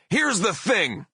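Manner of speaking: very high, punchy promo opener; pro wrestler bellow. Retold or imitated. Singer not finished with the booing crowd, wrestler bellow. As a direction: bellow for attention over the boos. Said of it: "bellowed in my best professional wrestler voice".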